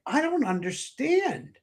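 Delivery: high, upset voice of a bewildered wife. Retold or imitated. Husband voicing his wife, confused by his calm, high bewildered voice. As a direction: pitch up into her confused disbelief.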